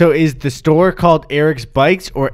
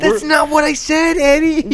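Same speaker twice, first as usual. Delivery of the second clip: indignant shout. Retold or imitated. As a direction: shout it, offended and shrill-high. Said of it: "They're like".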